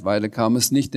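ordinary delivery, nothing marked

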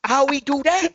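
put-on high comic Chinese accent, second man. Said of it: "I may do it in a Chinese accent".

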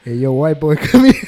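loud hammed-up call breaking into a laugh; mock boss calling out. Friend copying the boss's call, loud and laughing. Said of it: "a Japanese man who's just be like".

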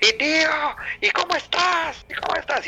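voz aguda y lenta del amigo español; amigo español preguntando. Retold or imitated. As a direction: imita al amigo que insiste desde arriba.

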